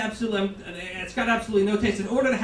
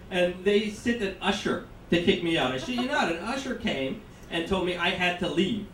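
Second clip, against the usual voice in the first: ordinary talk, nothing marked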